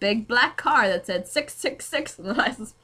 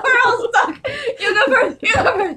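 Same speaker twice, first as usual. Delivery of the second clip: laughing; 14 semitones higher, 6 dB louder than usual. Pretend. Playfully acting out the scene.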